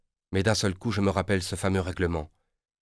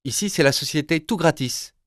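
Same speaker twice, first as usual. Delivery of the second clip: higher, louder receptionist's phone greeting; a polished telephone operator voice; company phone voice; 8 semitones higher, 4 dB louder than usual. Acted. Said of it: "dit la voix".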